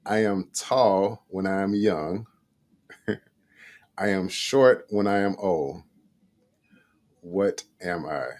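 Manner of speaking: slow, deliberate riddle reading with long pauses. Shown as a demonstration. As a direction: read each phrase slowly so it lands. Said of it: "I'm gonna read it one more time".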